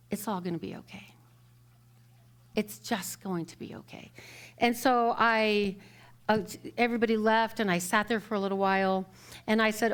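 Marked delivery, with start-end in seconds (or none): 0.1-4.1 soft, hushed comforting murmur; retold whisper. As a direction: imitate someone whispering reassurance in an ear. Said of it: "whispers in my ear".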